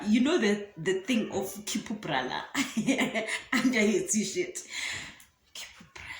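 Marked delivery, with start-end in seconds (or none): 5.6-6.1 the word breathed out in a near-silent whisper; barely-there secret whisper. Retold or imitated. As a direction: hush it like a secret.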